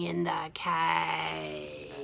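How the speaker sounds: breathy hushed hint of menace; spooky whisper. A narrator's reading. Storyteller to children, hushed spooky whisper. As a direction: whisper it like a secret in the dark.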